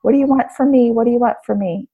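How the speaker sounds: high, pleading cry to God, repeated; retold crying out. Broke woman on her knees crying out to God, high pleading. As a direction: plead upward as if begging from your knees. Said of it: "just crying out".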